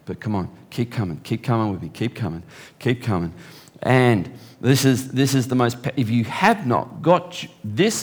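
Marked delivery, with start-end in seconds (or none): 0.0-3.3 softer, lower coaxing Holy Spirit; Holy Spirit gently urging a struggling believer on; gentle encouragement. Retold or imitated. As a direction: coax them along gently, hand in hand. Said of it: "the Holy Spirit is just holding your hand".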